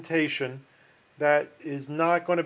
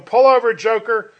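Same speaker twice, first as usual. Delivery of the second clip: loud, high joke-cop holler; acted police bellow; yelling cop; 6 semitones higher, 11 dB louder than usual. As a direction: shout it like an officer over a loudspeaker. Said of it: "he's yelling through the microphone".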